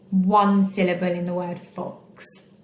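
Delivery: normal talking voice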